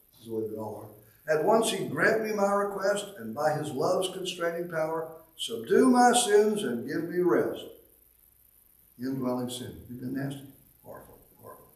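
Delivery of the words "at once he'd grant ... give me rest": solemn recited hymn verse, measured and lifted; hymn recitation. Acted. Preacher reciting a hymn to the congregation, solemn and lifted. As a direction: read the old hymn like a poem from the pulpit.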